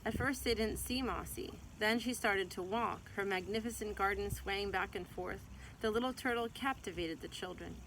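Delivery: gentle, lively read-aloud voice; storybook reading. Acted. Mother reading a picture book to her child, gentle storytelling.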